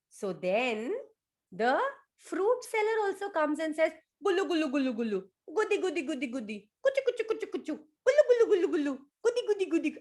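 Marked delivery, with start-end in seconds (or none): 4.2-10.0 high, playful tickle chant; tickling noises. Acted. Fruit seller tickling the elephant with high playful noises. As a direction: coo the tickle sounds playfully.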